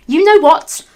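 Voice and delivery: loud, high outburst; angry rant. Acted. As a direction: snap at the haters. Reading angry author's note.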